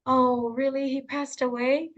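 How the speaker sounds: receive news of a death gently; hushed, sorrowful disbelief; sad news reaction. Shown as a demonstration. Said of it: "how about if someone passed away".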